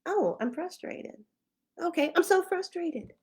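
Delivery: light, delighted discovery voice; sudden realization. Retold or imitated. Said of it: "it's like".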